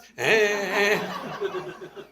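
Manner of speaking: a short real laugh at his own joke; amused laugh. Real, not acted. Swami laughing at the child's answer.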